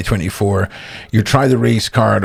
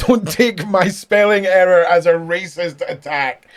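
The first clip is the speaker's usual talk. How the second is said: laughing; 7 semitones higher than usual. Real, not acted. Laughing at own message.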